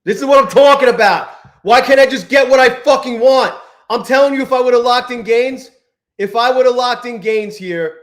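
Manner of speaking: loud, high frustrated venting; real exasperated rant; angry rant. Real, not acted. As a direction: rant at the market. Ranting about missed profit.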